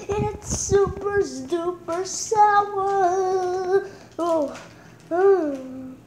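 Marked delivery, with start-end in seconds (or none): 0.1-3.9 slow, stretched sour complaint; slow drawn-out. Real, not acted. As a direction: drag out every word. Eating sour candy.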